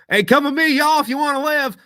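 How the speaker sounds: high, loud mock Arnold drawling y'all; Terminator with a Southern twang. Dubbing joke about Schwarzenegger, mock high loud Terminator. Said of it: "Terminator go around going".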